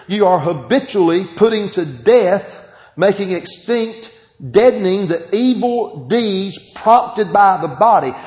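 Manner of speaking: loud, ringing scripture reading; preaching. Acted. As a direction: proclaim the verse to the church. Reads scripture at rising force.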